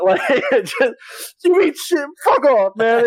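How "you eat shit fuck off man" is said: high, louder hammed-up tearful insults; mock crying. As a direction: mimic a sore loser wailing while running off. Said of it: "crying and running away".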